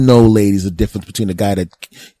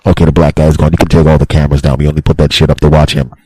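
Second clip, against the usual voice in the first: low conspiratorial staff voice; 8 semitones lower, 6 dB louder, faster than usual. Acted. Staff after he leaves.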